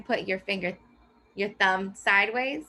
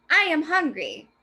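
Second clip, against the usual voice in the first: loud, higher excited exclamation; exclaimed; 6 semitones higher, 4 dB louder than usual. Shown as a demonstration. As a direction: exaggerate it like a shout. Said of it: "as loud or exaggerated".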